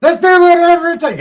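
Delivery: very high, loud mocking squeal; mocking politician. Imitating politicians urging people on, a high, loud mock squeal. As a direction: caricature a rabble-rousing official.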